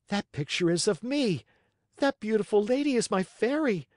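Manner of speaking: high, thrilled puppet voice; high-pitched delighted Pinocchio; happy excitement. A narrator's reading. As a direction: exclaim it in joyful recognition. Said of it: "He felt so happy".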